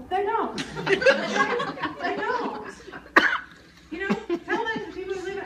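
laughing through the words; high laughing disbelief. Real, not acted. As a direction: crack up mid-sentence. Laughing at her own point.

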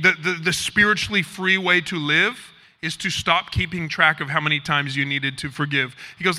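ordinary delivery

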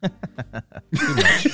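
laughing hard. Real, not acted. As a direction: crack up at the bit. Laughing at the spooky intro.

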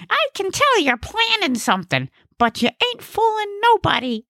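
very high, suspicious character voice; high-pitched comic accuser; suspicious Gnesi. Acted. Gnesi accuses Ig in the car, high suspicious voice.

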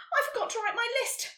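high-pitched panicky wail. A narrator's reading. Nelly panicking to Karen about her list, wailing. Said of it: "she wailed".

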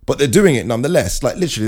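normal talking voice

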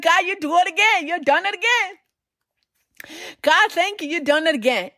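joyful praise. Acted. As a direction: lift it up in happy worship.

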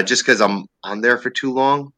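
ordinary talk

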